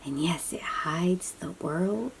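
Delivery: plain reading voice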